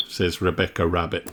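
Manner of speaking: ordinary delivery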